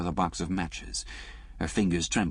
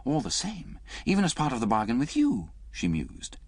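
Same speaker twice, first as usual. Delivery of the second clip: lightly voiced woman, higher; a hint of a wounded young woman, higher; Liz's voice; 9 semitones higher than usual. Reader voicing Liz to Alec, lightly higher.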